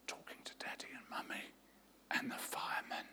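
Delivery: tiny whispered yes. A narrator's reading. Hiding child whispering to the caller. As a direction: barely breathe the word.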